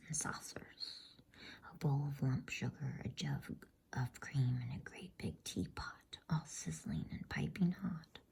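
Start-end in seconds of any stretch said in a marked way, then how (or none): none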